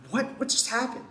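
light, high what-just-happened; baffled hobbits. Retold or imitated. Hobbits stunned as the ring vanishes, high and baffled. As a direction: voice jumps up in mock confusion.

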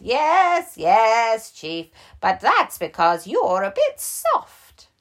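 high, loud teasing bear; old bear voice. Acted.

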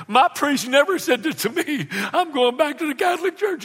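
high, mock-offended parishioner voice; huffy churchgoer. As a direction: flounce off in a pretend huff.

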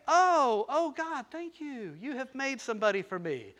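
mild high-voiced mock gentle thanks; polite thanks. Preacher mocking a tame Adam, mild prim thanks. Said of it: "He didn't say".

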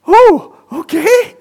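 high, slow, unsure mock Glaswegian lady; a weak Glaswegian accent, high and hesitant. Glaswegian lady unused to being prayed for, in a high, unsure mock Glaswegian accent. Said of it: "can't do my Glaswegian accent".

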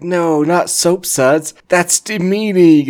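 high, loud, slow dying wail; melting wizards wailing. Acted. Wizards melted by soapy water, wailing high, loud and slow. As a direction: stretch it out like a melting wizard's protest.

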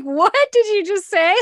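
high, loud disbelieving cry; retold high, loud outburst of disbelief; stunned auntie. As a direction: shoot it out in mock shock at the kid. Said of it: "I just looked at her and I'm like".

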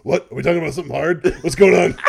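loud, high creepy monster voice cutting in; creepy hormone monster. Retold or imitated. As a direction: ham up the leering monster, laughing as it builds. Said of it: "all of a sudden he goes".

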